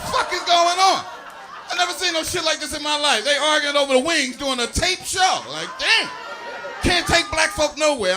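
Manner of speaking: loud, high disbelieving shouts; real exasperated yelling; exasperated rant. Real, not acted. Ranting at arguing audience.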